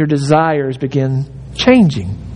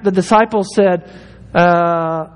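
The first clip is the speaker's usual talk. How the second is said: usual speaking voice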